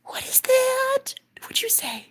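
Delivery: high, slow, breathless whisper; awed whisper. Retold or imitated. Stunned whisper asking her partner what he said. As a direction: whisper it in disbelief. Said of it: "I said".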